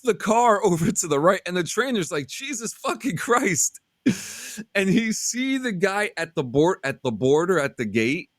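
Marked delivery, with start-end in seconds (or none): 2.2-3.8 shocked curse. Retold or imitated. Trainer's shocked reaction retold.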